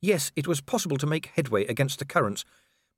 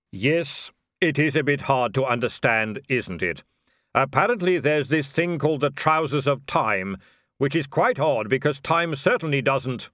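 grim reaper voice; 3 semitones higher, 3 dB louder than usual. Acted. Death speaking.

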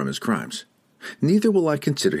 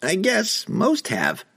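high, slow halfling voice; a small high voice, unhurried; 13 semitones higher, slower than usual. Acted. Halfling Don Don speaking.

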